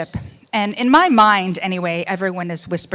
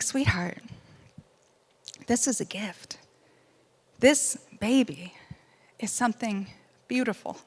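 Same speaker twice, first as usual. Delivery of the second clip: gentle husband; 7 dB quieter than usual. Retold or imitated. Husband reassuring his wife that the baby is a gift, soft and tender. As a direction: hold her calmly, quietly.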